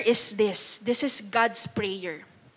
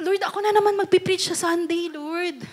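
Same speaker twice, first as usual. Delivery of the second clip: mock whine, higher and faster; whining churchgoer; 6 semitones higher, 3 dB quieter than usual. Preacher mimicking a member complaining to God, mock whining. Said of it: "Nakakarinig ba kayo dyan".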